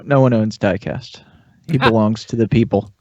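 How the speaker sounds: ordinary talk, nothing marked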